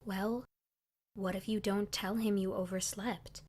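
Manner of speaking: hushed, like sharing a big secret; low secretive voice. A narrator's reading. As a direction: keep it low and conspiratorial. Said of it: "speaking very low like it was a big secret".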